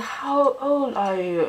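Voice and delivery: high, very slow question; slow singsong mimicked question; imitated careful English. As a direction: ask it word by word, like a learner.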